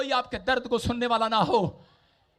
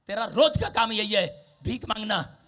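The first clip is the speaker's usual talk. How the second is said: ordinary talk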